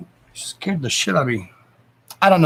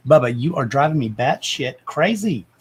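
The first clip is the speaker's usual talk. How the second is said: ordinary delivery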